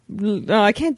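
usual speaking voice

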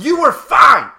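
loud, high-pitched resentment; indignant outburst. Acted. Injured man resents unhurt friend's apology, indignant outburst. Throws friend's escape back at him.

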